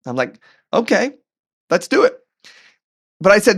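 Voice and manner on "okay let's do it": high-pitched acted yes; game agreement. Husband agrees to walk with wife, game agreement. Acts out reluctant-cheery agreement.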